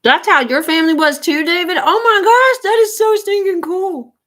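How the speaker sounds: very high, loud gushing delight; thrilled. Real, not acted. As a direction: squeal with excitement. Thrilled by viewer's family news.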